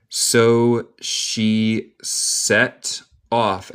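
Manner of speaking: very slow, word by word; teacher's slow, drawn-out model reading; slow dictation. Shown as a demonstration. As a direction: separate each word with a clear pause. Teacher models phrase slowly.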